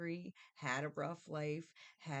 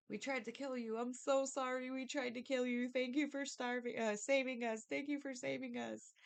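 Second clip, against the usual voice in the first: high, pleading apology; remorseful brothers; 7 semitones higher than usual. Retold or imitated. Brothers thanking Joseph for saving them, high and pleading. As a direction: beg forgiveness in a raised voice. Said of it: "that's your brother".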